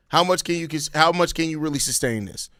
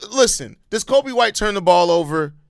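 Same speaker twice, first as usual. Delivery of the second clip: ordinary talk, nothing marked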